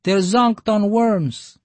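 put-on high, slow child's voice; little girl explaining. A narrator's reading. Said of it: "the girl explained".

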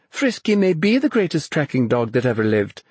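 high, merry comeback; amused, lifted-pitch retort; laughing reply. A narrator's reading. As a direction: counter him gleefully, starting high.

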